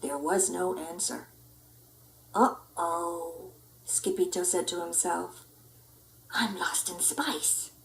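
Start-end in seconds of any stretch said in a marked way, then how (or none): 2.4-3.5 low, soft worried uh-oh. Acted. Lost pup realizing he's alone, worried. 6.3-7.7 slow scared whisper. Acted. Pup lost in space whispering to himself, scared.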